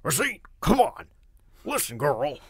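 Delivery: high, loud barking bark of a gruff racer; loud barking coach scolding his rider. Shown as a demonstration. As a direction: bark orders at Racine. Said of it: "so he's kinda barking".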